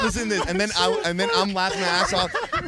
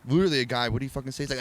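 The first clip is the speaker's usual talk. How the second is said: normal talking voice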